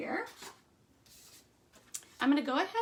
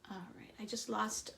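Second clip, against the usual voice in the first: whispered aside; 3 semitones lower, 8 dB quieter than usual. Real, not acted. Muttering to herself while working.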